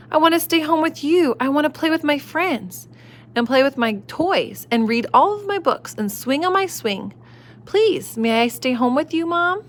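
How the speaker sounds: put-on high pleading child voice; whiny child. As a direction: plead like a little kid.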